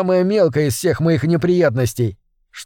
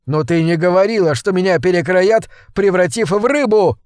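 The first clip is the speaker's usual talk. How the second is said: rising fury; 4 semitones higher than usual. Acted. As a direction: hurl the betrayal back at her. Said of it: "ярость".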